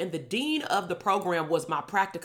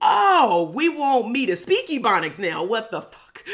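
loud, high exclamation of disbelief; mock outrage; 4 semitones higher, 6 dB louder, slower than usual. Student privately reacting to professor's request, mock outrage. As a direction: burst out high on the "oh", incredulous.

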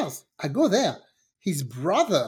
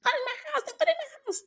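very high, soft mock scream; imitated shriek, thin and high; retold yelling; 6 dB quieter, much faster than usual. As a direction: squeal it like a yell with the volume down. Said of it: "I'm yelling".

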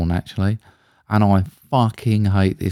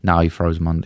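ordinary delivery

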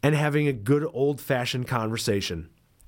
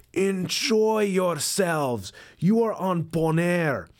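put-on slow emphatic Caribbean accent; 4 semitones higher, much slower than usual. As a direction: lean hard into the Caribbean lilt. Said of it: "And he really emphasized that".

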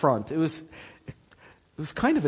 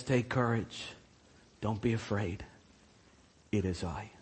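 soft reassurance; 7 semitones lower, 10 dB quieter than usual. Acted. Jesus quote, much softer.

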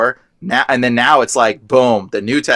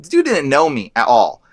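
ordinary talk

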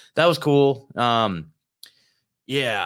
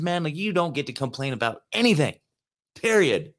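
higher-pitched self pep talk, lightly voiced; inner pep-talk voice; 7 semitones higher than usual. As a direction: scold yourself into gratitude, a little higher. Inner voice scolding him to gratitude.

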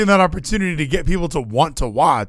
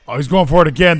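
normal talking voice

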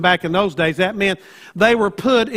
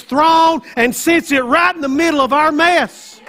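full-force preaching; 6 semitones higher than usual. Acted. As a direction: preach it out to the back row.

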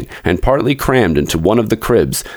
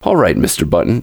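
usual speaking voice

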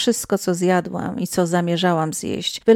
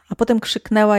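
ordinary delivery

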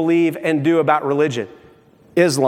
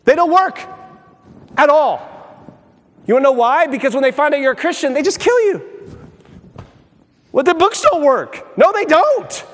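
preaching at full force; 5 semitones higher than usual. Acted. Preacher telling the congregation that church growth books fail in Iran, in a fiery, impassioned climax. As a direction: build it into a fiery sermon peak.

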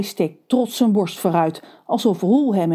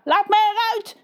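trapped yell; 13 semitones higher than usual. Acted. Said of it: "schreeuwt de heilige Kakka".

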